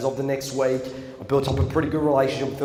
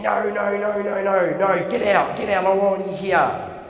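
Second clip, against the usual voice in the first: higher, louder, a telling-off principal voice; angry get out; 7 semitones higher, 4 dB louder than usual. Retold or imitated. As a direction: bark him out of the room. Principal throwing him out.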